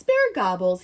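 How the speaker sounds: usual speaking voice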